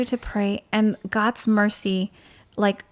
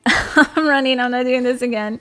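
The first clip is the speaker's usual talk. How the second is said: louder, amused mock alarm; laughing mock panic; 3 semitones higher, 6 dB louder than usual. Spotting the angry woman again from a distance, laughing mock panic. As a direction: blurt it louder through amusement.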